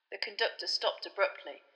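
usual speaking voice

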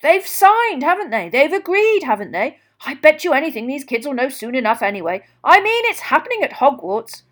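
high, loud, bubbling insistence; eager protest. A narrator's reading. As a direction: press the point with excited volume. Bagman brushing off Crouch's caution.

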